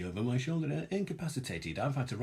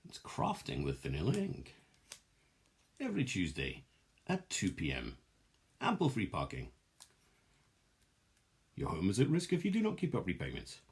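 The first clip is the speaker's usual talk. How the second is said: mock ad read. Mock advert tagline read.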